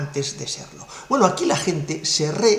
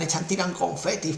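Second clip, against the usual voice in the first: normal talking voice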